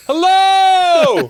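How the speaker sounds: high, booming hello, way up in pitch; hollering cantaloupe. Acted. Joking cantaloupe calls out a greeting, shouted high. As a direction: shout it out as a talking fruit. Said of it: "feel like a cantaloupe going".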